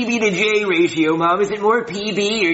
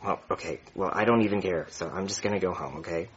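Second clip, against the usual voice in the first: deep sulky voice; 13 semitones lower, 9 dB quieter than usual. Acted. Kid voiced deep, sulking.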